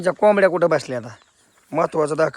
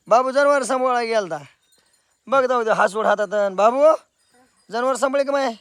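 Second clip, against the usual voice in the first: normal talking voice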